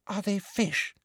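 soft, high, hushed question; hushed, high-pitched wonder; breathed. A narrator's reading. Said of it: "breathed Ginny".

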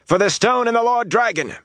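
very high-pitched battle cry; contained narrator's shout; shouted war cry. A narrator's reading. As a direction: take up the chant as another soldier, voice pitched high. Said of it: "a man shouted, and another".

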